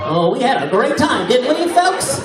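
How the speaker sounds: high, loud showman's put-on voice; mock offstage voice. Comic joking about a fake stage accent, mock offstage voice. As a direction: push it up into a hammed-up, exaggerated greeting. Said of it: "Is this my real accent or a stage accent?".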